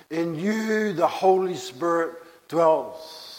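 usual speaking voice

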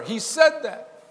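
high, loud, pressing; rising preacher's appeal; preaching loudly. Real, not acted. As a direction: drive it at the congregation. Preacher presses the point home.

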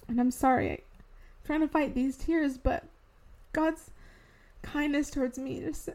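fighting off crying while still talking; choked-up, fading near tears; holding back tears. Real, not acted. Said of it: "try to say this without crying".

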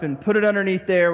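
usual speaking voice

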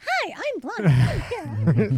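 high, slow, airy bimbo greeting; ditzy Blondie voice. Retold or imitated. Meeting the bartender, hamming up a ditzy blonde. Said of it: "Like, I was like that, like this".

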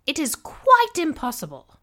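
high, loud and shrill. A narrator's reading. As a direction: scold at the top of her voice. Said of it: "Missus Bennet's shrill voice raised".

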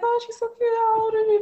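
very high cutesy voice; baby talk. Retold or imitated. Woman greeting a boyfriend's small child, high-pitched baby talk.

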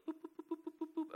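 light, high "boop" noises; high scampering boops. Retold or imitated. Imitating a boy running off to the bathroom, high boops. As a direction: imitate little feet scurrying off in boops.

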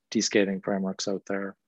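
ordinary delivery

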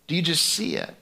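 normal talking voice

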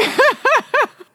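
laughing fit; high squealing laugh. Real, not acted. Laughing at own joke.

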